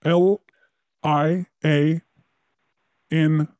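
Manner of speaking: slow letter by letter; spelling it out. Real, not acted. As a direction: spell each letter out slowly. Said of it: "Can you spell that for me".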